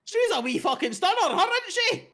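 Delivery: gushes over her; delighted shopkeeper. Acted. Shopkeeper gushing about the goddess, high and delighted.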